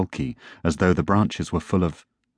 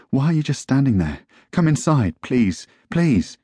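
higher, urgent plea; anxious pleading; 6 semitones higher than usual. A narrator's reading. Boy begging his father to come inside, anxious pleading. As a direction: plead as if afraid for someone.

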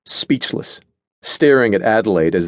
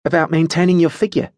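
higher-pitched, matter-of-fact character voice; 8 semitones higher than usual. A narrator's reading. Adelaide answers Natasha about her figure, matter-of-fact. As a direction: state it as simple fact. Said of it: "in a matter-of-fact tone".

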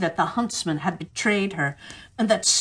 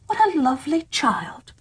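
higher, lightly voiced delighted dwarfs; 7 semitones higher than usual. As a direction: marvel at the sleeping child. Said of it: "cried they".

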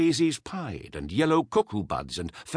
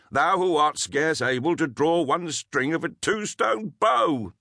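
mocking forester; 5 semitones higher, 5 dB louder than usual. A narrator's reading. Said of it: "one who held a horn of ale in his hand said".

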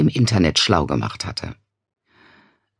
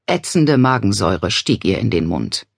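ordinary delivery, nothing marked

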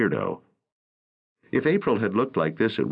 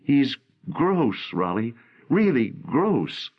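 higher, disgusted young woman, lightly voiced; revulsion; 6 semitones higher, much slower than usual. A narrator's reading. April describing the stalker to Raleigh, mild revulsion. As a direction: let the disgust drag out gross. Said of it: "her voice filling with revulsion".

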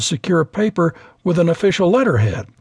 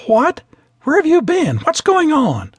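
startled outburst; 11 semitones higher than usual. A narrator's reading. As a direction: burst out in shock at seeing him. Attendant sees missing pilot return.

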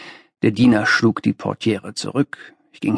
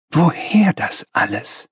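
wondering question, pitched higher; 5 semitones higher than usual. A narrator's reading. Young visitor asks about the master's rooms, questioning. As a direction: ask it with open curiosity. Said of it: "sagte ich fragend".

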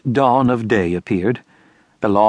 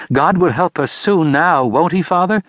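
put-on young child; 7 semitones higher than usual. A narrator's reading. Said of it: "said my youngest child".

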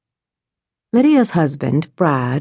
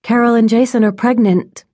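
pleased and excited; 7 semitones higher, 3 dB louder than usual. A narrator's reading. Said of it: "She made sure she sounded pleased and excited".

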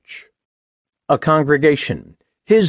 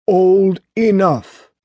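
slow, higher-pitched father's verdict; father's final word; 7 semitones higher, 3 dB louder, much slower than usual. A narrator's reading. Father replying to his daughter's protest, slow decisive voice. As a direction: draw it out as a parent closing the matter.

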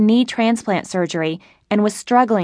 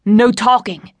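a sharp, raised command; shouted order; 2 semitones higher, 3 dB louder, slower than usual. A narrator's reading. Robber silencing the narrator, sharp shout. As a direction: cut the hostage off mid-comfort. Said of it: "Mister SpongeBob shouted".

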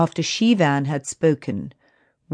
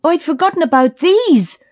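high, loud burst of delight; 11 semitones higher, 5 dB louder than usual. A narrator's reading. Billy pulls out forgotten berries, delighted exclamation. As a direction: exclaim over a happy find.

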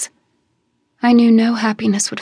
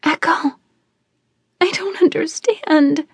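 put-on high, slow childlike voice; young cousin bewildered by the news; upset little girl; 7 semitones higher than usual. A narrator's reading. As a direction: speak like a confused young girl. Frightened cousin speaking.

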